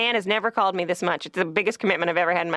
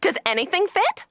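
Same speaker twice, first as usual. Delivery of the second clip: high, mock sales-girl chirp; mean sales girl; 12 semitones higher than usual. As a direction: imitate a mean shop girl in a raised voice. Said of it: "she was incredibly mean".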